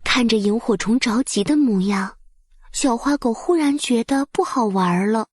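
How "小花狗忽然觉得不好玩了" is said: usual speaking voice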